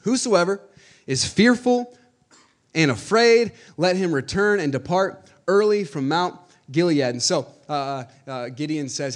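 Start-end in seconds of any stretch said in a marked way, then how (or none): 0.0-7.0 loud, high proclamation read out to the crowd; proclaiming loudly. Acted. Pastor reads Gideon's call to the fearful men, loud proclamation. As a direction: cry it out like a herald.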